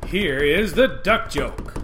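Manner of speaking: loud, drawn-out big reveal; showman's announcement. Pretend. Host unveils his duck joke after a drum roll, grand announcement.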